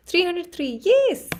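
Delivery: high, loud cheer at the sub count; excited cheer. Real, not acted. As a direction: celebrate the new number out loud. Seeing subscriber count rise.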